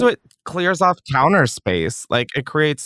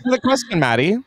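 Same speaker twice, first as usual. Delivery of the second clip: mock stern; 7 semitones higher than usual. Mock command to listener.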